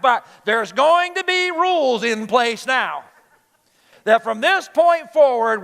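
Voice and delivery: loud, high parental lecture; hammed-up strict father, emphatic and pushy; stern rule-setting dad. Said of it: "it would go like this".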